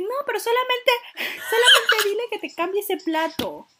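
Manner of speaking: indignación a gritos entre risas, más aguda; indignada. Retold or imitated. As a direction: reclama furiosa pero riéndose. Queja indignada a la familia. (queja a gritos y riendo contra la familia indecisa)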